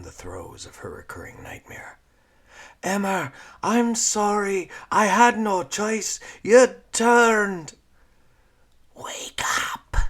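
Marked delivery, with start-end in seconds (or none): 2.8-7.8 loud, high desperate apology; anguished pleading. Acted. Nightmare pleading with zombie sister. 8.9-10.0 eerie whispered shriek; zombie scream. Acted. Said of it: "the zombified voice ... screamed".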